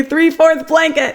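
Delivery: put-on child copying the silly storybook camel; Humphrey voice from the child. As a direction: answer giggly in the silly camel voice. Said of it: "the child would laugh and say".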